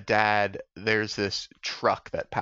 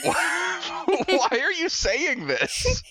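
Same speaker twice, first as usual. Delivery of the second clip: incredulous; much slower than usual. Retold or imitated. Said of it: "And it's like".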